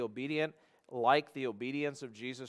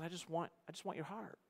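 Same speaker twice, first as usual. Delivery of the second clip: very soft, slightly higher entreaty; hushed sincere plea; 4 semitones higher, 11 dB quieter than usual. Acted. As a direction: plead gently, almost under your breath. Husband's soft answer acted out.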